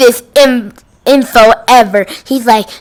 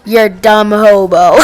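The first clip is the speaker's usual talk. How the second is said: a hint of a put-on voice on "You're a dumb hobo"; deadpan mock insult in a slightly changed voice; lightly voiced employee insult; 3 semitones lower than usual. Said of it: "He's just like".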